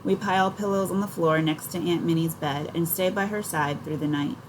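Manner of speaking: ordinary delivery, nothing marked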